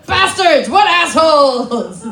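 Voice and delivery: loud mock-outraged yell, drawn out at the end; mock outrage. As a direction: shout the insults in hammed-up indignation. Mock outrage at flower givers.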